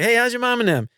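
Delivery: chirpy New Orleans Yat hello; New Orleans Yat greeting. Retold or imitated. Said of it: "Everyone's like".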